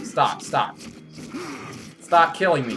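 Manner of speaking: normal talking voice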